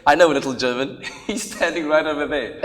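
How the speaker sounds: loud, laughing through the words; laughing while talking. Real, not acted. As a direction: push the line out between laughs. Joke quote while laughing.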